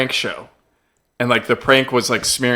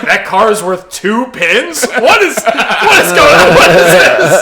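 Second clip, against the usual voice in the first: laughing disbelief; 5 semitones higher, 6 dB louder, slower than usual. Retold or imitated. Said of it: "I was like".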